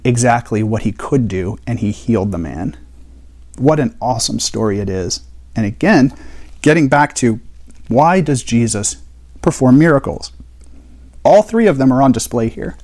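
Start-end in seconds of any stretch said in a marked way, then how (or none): none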